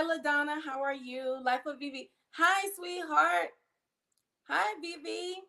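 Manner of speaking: ordinary delivery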